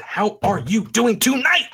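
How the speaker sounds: a high, far-off MC call to the crowd; hype-man call. Pretend. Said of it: "I said".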